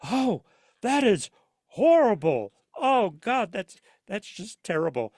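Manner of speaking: high, loud mock horror at a bad AI voice; appalled listener. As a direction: recoil as if the sound hurts your ears. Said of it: "you're going".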